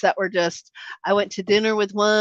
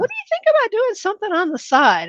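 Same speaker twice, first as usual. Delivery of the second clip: eager question; 12 semitones higher than usual. Retold or imitated. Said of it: "And she's like".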